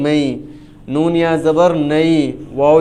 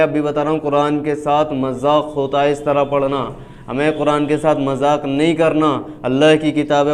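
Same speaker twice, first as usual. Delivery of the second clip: normal talking voice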